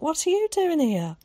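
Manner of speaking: high, loud astonished question; can't believe she's here; amazed. Acted. Zack asking Carrie why she is there, amazed and loud. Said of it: "he cried in amazement".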